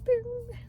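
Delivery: high, ringing "bing" noise; light-bulb ding. Retold or imitated. Telling of a sudden realization, high imitated ding. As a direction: imitate a little bell going off.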